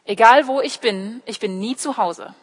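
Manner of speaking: usual speaking voice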